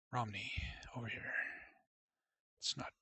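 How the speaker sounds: soft whispered planning to himself; whispered aside. Real, not acted. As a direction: whisper it under your breath. Quiet aside while planning route.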